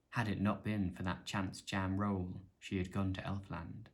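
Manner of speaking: measured storybook reading, fuller voice; story narration. A narrator's reading. Narrator reading the troll and fox tale aloud, storybook voice. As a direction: tell the tale to listeners, unhurried.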